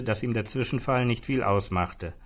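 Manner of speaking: plain reading voice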